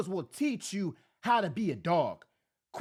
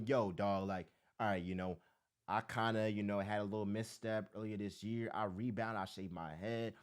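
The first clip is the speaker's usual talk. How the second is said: much lower, soft, laid-back voice; coach's pep talk; 12 semitones lower, 8 dB quieter than usual. Retold or imitated. One coach consoling another, low and soft. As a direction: drop way down and talk him through it calmly. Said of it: "Sirianni that's gotta tell him".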